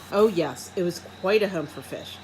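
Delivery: ordinary delivery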